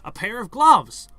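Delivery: sudden loud eureka; excited discovery. Pretend. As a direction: shout out the idea. Foley artist's sudden idea.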